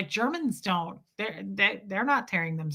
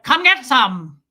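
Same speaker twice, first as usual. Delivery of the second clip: put-on loud, punched German-accented challenge; forceful German accent, curt and loud; scary German accent; 3 semitones higher, 9 dB louder, slower than usual. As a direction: face down an intruder as a fierce German woman. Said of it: "with Sophia in there".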